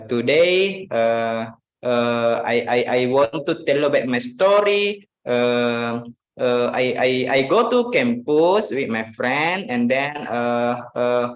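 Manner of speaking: hesitant English, stumbling and repeating between fillers; filler-laden hesitation. Shown as a demonstration. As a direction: imitate a halting learner stalling on "uh". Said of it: "you ever heard someone speak like this".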